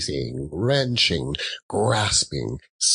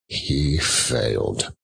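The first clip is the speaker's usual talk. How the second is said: ordinary delivery, nothing marked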